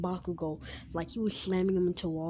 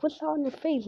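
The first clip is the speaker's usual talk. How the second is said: put-on high curious little girl; 9 semitones higher, 3 dB louder than usual. Eri asking about the blood, high childlike question. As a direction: ask it up high like a small child. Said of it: "Eri's like".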